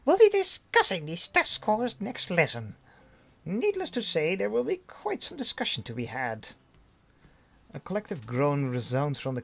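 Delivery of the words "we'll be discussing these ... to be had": high-pitched teacher voice; teacher's parting broadside. Acted. Teacher warning the class about test scores, high-voiced broadside. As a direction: call it out to the class in a higher voice. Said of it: "the teacher delivers a parting broadside".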